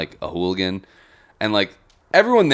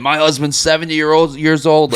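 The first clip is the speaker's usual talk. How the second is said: louder, high-pitched imitation of the woman; mock protest; 7 semitones higher, 5 dB louder than usual. Drunk regular answering the bartender, mock protest. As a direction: push it up high as her protest.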